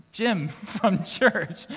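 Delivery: drawn out, slow, with a laugh through it; patient, amused "Jim from church" said slowly; slow laughing reminder. Retold or imitated. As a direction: spell it out slowly while chuckling. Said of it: "Jim had to say".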